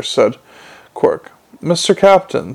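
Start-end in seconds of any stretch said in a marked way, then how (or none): none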